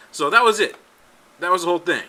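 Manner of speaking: ordinary talk, nothing marked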